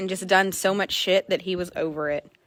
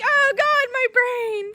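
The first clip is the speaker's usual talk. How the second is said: higher, crying wail; mock crying; 14 semitones higher, much slower than usual. Owner acts out her despair over the horse, mock crying. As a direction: whine it like you're falling apart. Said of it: "And I'm like".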